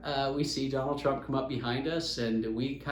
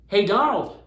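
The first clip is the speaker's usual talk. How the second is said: higher, louder retold hail; calling out; 5 semitones higher, 8 dB louder than usual. Golfer hails Donald as he pulls away, calling out. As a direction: call after someone leaving. Golfer calls to departing Trump.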